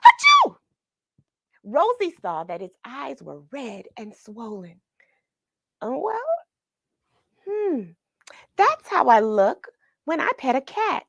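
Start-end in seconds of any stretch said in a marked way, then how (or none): 0.1-0.6 loud, very high owl sneeze; big sneeze. Acted. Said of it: "the owl let out a big sneeze". 5.8-11.0 higher musing girl voice, lightly voiced; Rosie thinking. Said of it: "Rosie thought".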